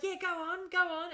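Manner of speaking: high-pitched mock egging-on; lightly acted kid eagerness; imitating eager kids. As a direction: voice excited children urging someone on. Voices her kids egging her on.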